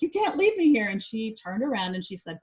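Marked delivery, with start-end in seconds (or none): 0.0-0.9 high, loud imitated child's protest; dramatized pleading, pitched way up; retold desperate plea. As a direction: cry out as a child begging grandma to stay. Repeats child's plea, more forcefully.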